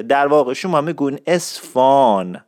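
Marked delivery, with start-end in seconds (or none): 1.3-2.4 "اصفان" with the h dropped and long aa; correct local pronunciation. Shown as a demonstration. Teacher showing how the word evolved, h-less drawn-out "اصفان". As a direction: stretch the open vowel in "اصفان": es-faaan. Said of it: "این آ".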